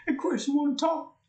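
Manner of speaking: high, loud put-on voice; comic high-pitched question; quoting a song's character. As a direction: imitate the song line in a squeaky-high voice. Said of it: "That's what he says in the song".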